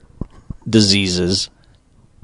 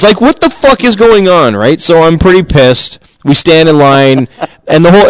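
heated rant; 6 semitones higher, 4 dB louder than usual. Real, not acted. Continuing the angry rant.

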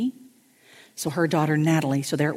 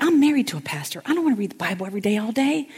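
mock exasperated protest; 6 semitones higher, faster than usual. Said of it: "And I'm like".

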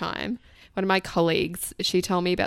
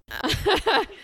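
laugh; 13 semitones higher than usual. Real, not acted. Laughing at own joke.

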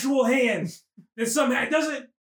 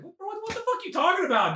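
high-pitched stammered disbelief; mock bafflement, voice shooting up; incredulous comeback; 5 semitones higher than usual. Liz answering his come-on, incredulous. Acting out Liz rejecting him.

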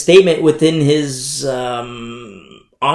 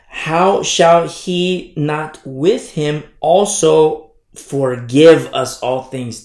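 slow, deliberate, stress on forgive; reading it slowly, leaning on forgive; corrected reading; 4 dB louder, much slower than usual. Shown as a demonstration. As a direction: drag it out and lean on forgive. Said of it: "how it translates better in the Greek".

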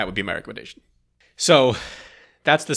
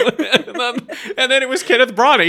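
much higher and louder, laughing; laughing reveal; 8 dB louder than usual. Real, not acted. Reveal told through laughter.

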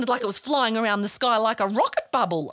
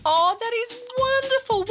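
delighted praise; 11 semitones higher than usual. Real, not acted. Praising child's answer.

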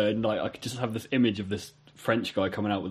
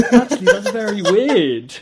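laughing; 8 semitones higher, 7 dB louder than usual. Retold or imitated. Hindsight realisation while laughing.

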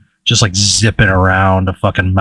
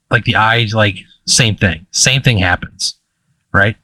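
ordinary delivery

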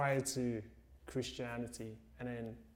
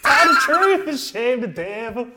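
shouted high, slow and whooping; loud hype call; 12 semitones higher, 19 dB louder, slower than usual. Real, not acted. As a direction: cheer her on loudly. Host hypes her confession.

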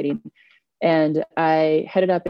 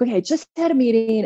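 raised, eager retelling voice; eager announcement; 7 semitones higher, 3 dB louder than usual. Retold or imitated. Younger self walking into a colleague's office, eager and high. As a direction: launch in with news, pitched up.

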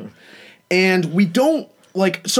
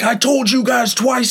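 higher pitched mock angry neighbor; imitating an irritated gym guy, raised pitch; 8 semitones higher than usual. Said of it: "he comes out flexing".

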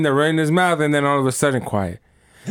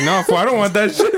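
laughing refusal; 7 semitones higher than usual. Retold or imitated.

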